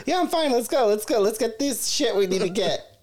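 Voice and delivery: high, hurried and laughing; squeaky laughing I'm fine; flustered brush-off. Retold or imitated. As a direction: wave everyone off, embarrassed. Insisting she's fine after fall.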